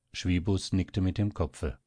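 ordinary delivery

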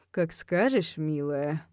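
low, soft, indulgent purr; velvety, lazy agreement. A narrator's reading. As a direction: purr it to your sweetheart. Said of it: "мурлыкнул он".

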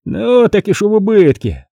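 high, dismissive retort; scoffing. A narrator's reading. Monk answering the commander's complaint, scoffing. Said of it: "хмыкает монах".

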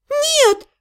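high-pitched cry of horror; horrified yell. A narrator's reading. Heroine denying the pregnancy rumour, horrified high cry. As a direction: shoot up high in horrified denial. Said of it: "завопила я в ужасе".

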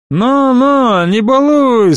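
sleepy startled yell, high; rudely awakened shout. A narrator's reading. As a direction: shout half asleep at the horse. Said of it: "спросонья заорал извозчик".

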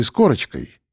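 plain reading voice

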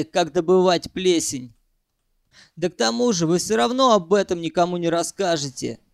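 plain reading voice

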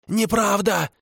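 high shrill denial shout; shouting kikimora. A narrator's reading. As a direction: scream the denial at him. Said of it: "закричала она".

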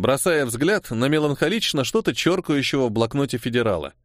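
usual speaking voice

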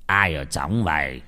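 low, slow, gentle questioning. A narrator's reading. Monk asking who is inside possessed Tú, gentle. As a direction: coax the spirit out gently and unhurriedly. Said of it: "Vị sư cất giọng nói nhẹ nhàng".

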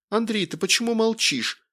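high-pitched, panicky woman's voice; verging on hysteria. A narrator's reading. Wife on phone meets silence, high near-hysterical demand. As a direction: press for an answer, close to hysterics. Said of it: "Голос в телефоне был на грани истерики".

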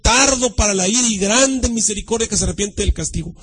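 slow, emphatic Bible reading, key words stretched; declaimed scripture reading. Acted. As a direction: proclaim the verse with weight. Reads scripture aloud with force.

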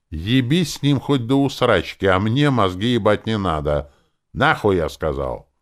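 loud, forceful telling-off; angry quarrel. A narrator's reading. Druid refuses to listen to Lenora, angry. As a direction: lay down the law angrily.

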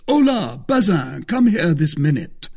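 high, loud summons; calling a servant. Acted. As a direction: call out sharply for the servant to come. Master calls his servant over.

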